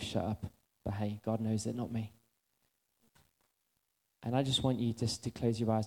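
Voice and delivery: low, soft, reflective preaching; quiet altar call. Acted. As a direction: draw them inward gently.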